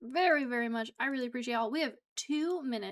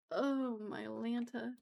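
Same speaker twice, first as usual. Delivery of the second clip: ordinary talk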